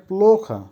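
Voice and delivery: প্লোখা with a long, stressed first syllable; careful model of প্লোখা, long first vowel; pronunciation demo. Shown as a demonstration. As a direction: stretch the "o" in প্লোখা, then drop. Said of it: "উচ্চারণ হবে".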